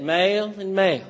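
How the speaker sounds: "Male and male" both long a, Tennessee vowel; same word twice in a Southern drawl; Southern drawl again. Retold or imitated. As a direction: Tennessee accent, no difference heard. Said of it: "And she said".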